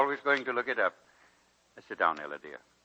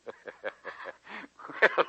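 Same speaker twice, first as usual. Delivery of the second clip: hearty chuckle. Acted. Old president laughs at insult.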